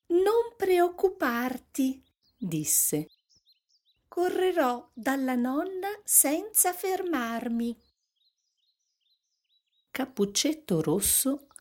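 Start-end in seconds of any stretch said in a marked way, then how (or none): 0.1-2.0 put-on high, slow little-girl voice. A narrator's reading. Little Red reassuring her mother, little girl voice. 4.1-7.8 put-on high-pitched child's voice; little girl. A narrator's reading. Little Red promising her mother, little girl voice.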